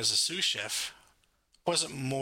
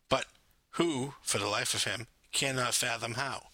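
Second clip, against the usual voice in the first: plain reading voice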